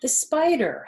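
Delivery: usual speaking voice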